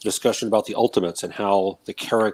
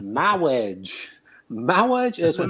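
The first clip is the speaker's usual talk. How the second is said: lisping "mawwiage" said slowly; drawn-out "Mawwiage" with a w; Impressive Clergyman impression; 5 semitones higher, much slower than usual. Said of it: "character development".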